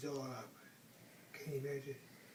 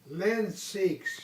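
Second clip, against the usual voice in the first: Mama Bear's voice; 4 semitones higher, 7 dB louder than usual. Acted. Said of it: "in her middle-sized voice".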